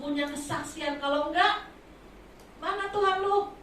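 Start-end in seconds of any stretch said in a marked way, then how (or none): 2.6-3.5 mocking scoffer. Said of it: "Kata orang".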